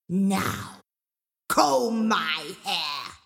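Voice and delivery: very slow, drawn-out command; growling ogress. Acted. Mama Draga ordering Angelina to comb, slow growl. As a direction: stretch it out menacingly. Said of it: "Mama Draga growled".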